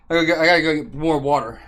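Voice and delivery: ordinary talk, nothing marked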